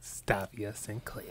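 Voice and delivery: slow breathy whisper of the name; whispered stage name. Pretend. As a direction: savour the name in a hush. Mock stage name reveal.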